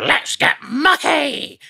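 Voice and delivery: very high, slow cartoon voice; British version of the catchphrase, high and slow; Muck's catchphrase. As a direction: stretch it out high and bright like a cartoon digger. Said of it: "Muck would always go".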